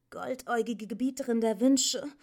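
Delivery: high, fast, stumbling address; flustered stammer. A narrator's reading. Atreyu addresses the Empress in confusion, stammering. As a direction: trip over the grand title. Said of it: "stotterte er".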